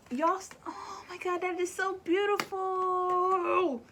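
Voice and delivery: high, slow gasp of wonder at the card; touched, almost in tears; moved and awed. Real, not acted. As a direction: let it rise high and break slowly into awe.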